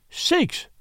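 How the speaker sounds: high, sudden exclaim of surprise; startled exclamation. Acted. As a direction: blurt it out in shock. Sudden realization of murder.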